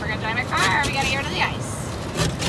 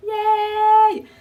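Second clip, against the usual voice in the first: excited cheer; 8 semitones higher, 7 dB louder than usual. Real, not acted. Done with classes.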